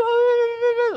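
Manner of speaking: a delighted high-pitched dog-owner squeal. Retold or imitated. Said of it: "her voice gets super high".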